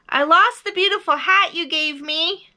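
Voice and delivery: high, loud confession; big high-pitched bear voice; calling out. A narrator's reading. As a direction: call it far across the mountains. Bear calls across to the moon.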